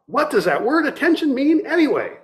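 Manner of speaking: a touch of anger, demanding an answer; half angered demand. Acted. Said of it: "Half angered, the man demanded".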